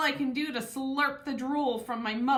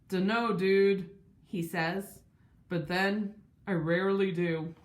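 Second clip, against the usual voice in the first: lower, easygoing surfer-dude voice; laid-back dude; 4 semitones lower than usual. Acted. Lance the yellow lab speaks.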